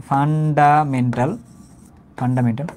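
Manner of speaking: Fundamental slowly, broken into syllables; dictation pace. Shown as a demonstration. Teacher explaining what F stands for, fundamental slowly by syllable. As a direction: spell out fundamental by sound, unhurried. Teacher repeats term for writing down.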